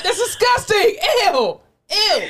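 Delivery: shrieking "that's disgusting" at the thought; disgusted shout. Real, not acted. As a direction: yell how gross it is. Grossed out by shared washcloths.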